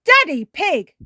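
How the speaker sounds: high, loud outburst; scolding shout. Acted. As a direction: shout his name in shock. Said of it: "she shouts".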